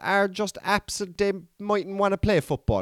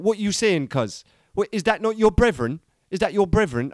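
higher, put-on Jamaican London street talk; Jamaican London slang; 4 semitones higher than usual. Fan quoting the show's gang, in a Jamaican London accent. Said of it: "this Jamaican London kind of crowd".

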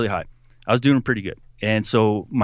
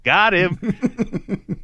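mock triumph; 9 semitones higher than usual. Mimicking recruiter's sly triumph.

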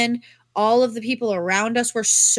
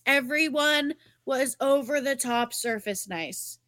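ordinary talk, nothing marked

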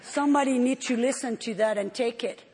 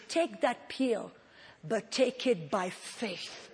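slow and soft, like a calm reassuring voice from above; gentle divine voice; 3 semitones lower, 7 dB quieter, slower than usual. Retold or imitated. Quoting the Holy Ghost to the congregation, slow soft reassurance. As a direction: ease each word out slowly and softly.

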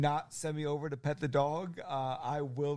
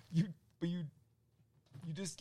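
ordinary delivery

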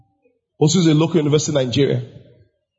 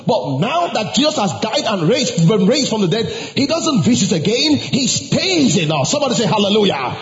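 high, insistent sermon delivery; preaching; 7 semitones higher than usual. Real, not acted. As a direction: drive the message home to the congregation. Preaches to congregation.

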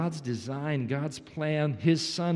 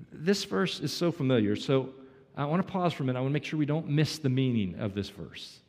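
ordinary talk, nothing marked

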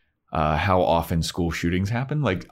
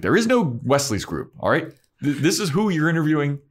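Willow barking orders at the group; snapping Willow; 9 semitones higher, faster than usual. Retold or imitated. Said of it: "Willow, like, snaps at everybody".

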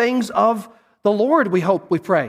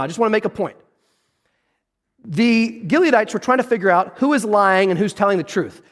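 ordinary delivery

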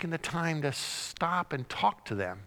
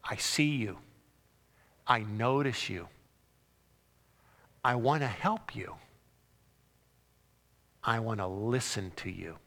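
gentle, tender Jesus; 4 semitones lower than usual. Acted.

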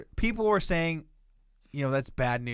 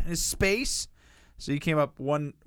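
ordinary talk, nothing marked

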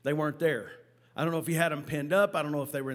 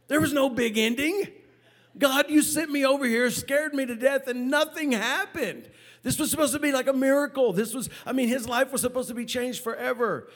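much higher and louder, incredulous protest to God; venting at God, pitched way up; exasperated outburst; 8 semitones higher, 4 dB louder than usual. Retold or imitated. Complaining to God afterwards.

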